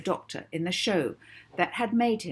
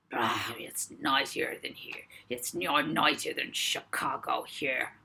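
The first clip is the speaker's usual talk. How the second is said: grumbling; 3 semitones lower than usual. A narrator's reading. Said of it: "he grumbled to himself".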